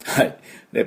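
ordinary talk

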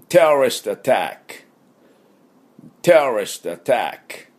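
テロリストアタック said clearly, stress on テ, for students to repeat; model terrorist attack for repeating. Shown as a demonstration. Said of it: "私の後について発音いきましょう".

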